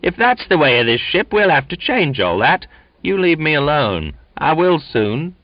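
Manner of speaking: high, loud indignant yelp. A narrator's reading. Duncan protesting to the crowd, indignant yelp. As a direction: yelp the complaint. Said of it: "Duncan yelped".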